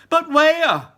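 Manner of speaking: high, loud panicked shriek. Acted. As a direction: shriek it at Wonka. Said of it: "shrieked Missus Salt".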